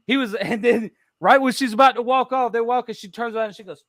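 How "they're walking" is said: ordinary talk, nothing marked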